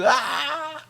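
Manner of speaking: a high, soft cry of emotion; soft emotional groan; moved cry. Real, not acted. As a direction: let out an overwhelmed wail. Overwhelmed exclamation.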